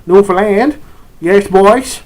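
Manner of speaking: hearty-volume Newfoundland accent impression. Shown as a demonstration. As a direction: call it out like a Newfie. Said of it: "which would be Newfies".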